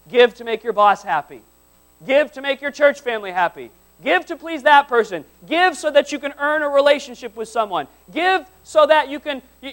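loud, driving preacher with pitch climbing through the list; forceful preaching. Real, not acted. Preacher warning the congregation about burnout, loud forceful preaching.

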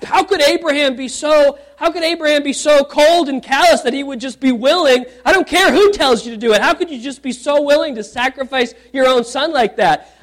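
mock the scandalized reader, pitch up; imitating shocked people. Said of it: "they gasp".

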